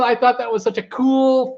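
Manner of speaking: ordinary talk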